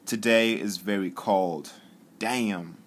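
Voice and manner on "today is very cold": slow, careful dictation, a bit louder; dictating to the phone. Shown as a demonstration. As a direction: say it slowly and clearly so the app catches it. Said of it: "Let me just tap on that".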